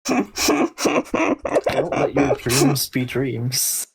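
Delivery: drawn-out, very slow delivery; slow meme quote. Pretend. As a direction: stretch every word like the famous meme. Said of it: "quoting a meme slowly".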